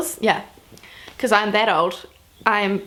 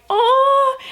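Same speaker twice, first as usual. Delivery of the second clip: high, drawn-out mock "aww"; manager's sympathetic coo; 13 semitones higher, 3 dB louder than usual. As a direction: imitate a pitying coo, pitched way up. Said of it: "her manager was like".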